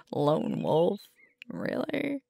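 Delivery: high, loud, laughing incredulity; amused disbelief. Acted. Alpha teasing a partner over a bad joke, amused disbelief. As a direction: toss it back, incredulous and tickled.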